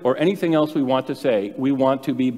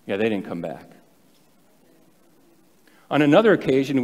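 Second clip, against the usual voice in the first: ordinary talk, nothing marked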